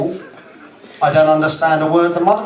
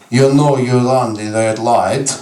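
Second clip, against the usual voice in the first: mock Russian accent; 4 semitones lower, slower than usual. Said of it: "he says".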